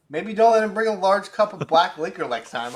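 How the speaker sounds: mock-exasperated outburst; retold exasperated advice. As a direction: raise your voice, incredulous, as if retelling it. Retelling his exasperated warning to friend.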